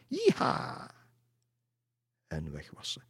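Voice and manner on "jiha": whooped "jiha", voice leaping up; high-pitched yee-haw whoop. A narrator's reading. Lady spurs her horse away, whooping. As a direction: whoop like a rider galloping off.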